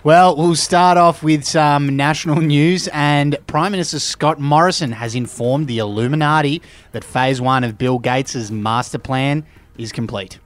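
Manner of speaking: normal talking voice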